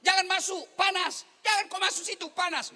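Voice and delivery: mock-shouting a warning to stay out; retold yell. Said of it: "dia teriak".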